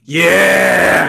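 loud cheering yeah; excited shout. Real, not acted. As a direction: shout it with joy. Cheering the scene.

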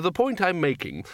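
acted fluster, searching for the argument; losing the thread. A narrator's reading. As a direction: stumble towards your point. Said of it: "trying to remember".

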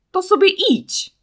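a high, loud, furious yell; angry shout. A narrator's reading. Boy yells at his friend to go her own way, furious and loud. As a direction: snap back at her, boiling with rage. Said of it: "krzyknął Marcin".

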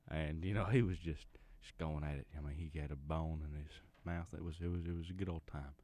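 ordinary talk, nothing marked

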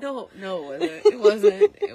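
high, loud denial; loud protest. Real, not acted. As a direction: insist it wasn't. Protesting friends' teasing.

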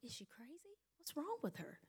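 frantic hushed inner monologue; inner thoughts. Retold or imitated. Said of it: "I'm like".

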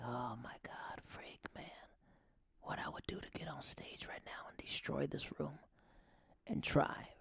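hushed eager whisper. Retold or imitated. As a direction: whisper it like a secret wish. Whispered inner thought on stage.

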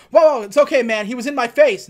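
defensive protest. Retold or imitated. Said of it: "he goes".

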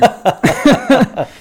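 loud high burst of laughter. Real, not acted. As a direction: let out a big laugh. Laughing at own story.

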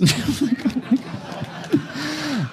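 a burst of high laughter. Real, not acted. Laughing at the kid's remark, high laugh.

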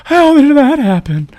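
high, loud puzzled voice; mock bewildered Nancy. Retelling Nancy finding Freddy's hat, high mock puzzlement. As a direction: play the stunned heroine. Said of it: "she's just like".

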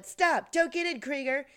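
imitated urgent yell, high and loud. Jeffries chasing Krieger to his car, imitated yell. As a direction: call out a warning in mock panic. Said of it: "and she's yelling".

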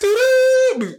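high, loud "doo-doo-doo"; imitated chase tune. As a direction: sing a quick high chase sound. Imitating running sound.

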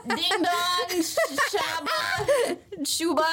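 high, slow, laughing call of the names; calling silly names. Retold or imitated. Jetpack guy calling his lost crew by name, high and giggly. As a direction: sing out nonsense names, cracking up. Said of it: "he was like".

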